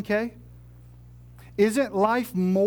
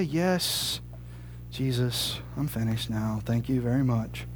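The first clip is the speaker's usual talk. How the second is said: sulky reply; 9 semitones lower, 5 dB quieter, slower than usual. Acted. Sulky reply after being told off.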